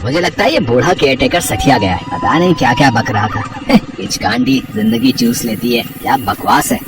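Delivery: low, dismissive mutter with a chuckle; scornful. Acted. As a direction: scoff at the old man's tale. Youth dismisses old caretaker's warning.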